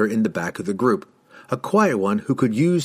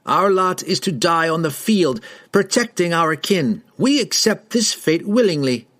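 higher, solemn rider's oath; proud declaration; 7 semitones higher than usual. A narrator's reading. Rider tells strangers of his duty, proud declaration. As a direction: declare your duty like a sworn warrior.

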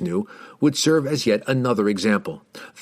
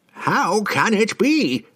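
slow, high, anguished cry; desperate old man; 11 semitones higher, much slower than usual. A narrator's reading. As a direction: cry out in despair. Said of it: "he cried desperately".

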